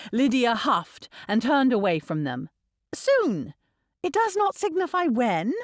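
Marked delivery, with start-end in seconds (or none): 2.9-5.5 put-on high, petulant girl; huffy dismissal. A narrator's reading. Niece dismissing her uncle's question, huffy. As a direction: brush the question off in a huff. Lydia huffs and turns away.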